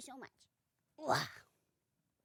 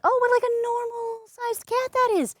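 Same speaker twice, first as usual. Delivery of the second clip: high mock guess; 12 semitones higher than usual. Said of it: "you might be like".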